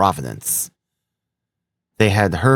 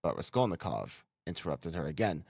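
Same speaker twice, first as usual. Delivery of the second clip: plain reading voice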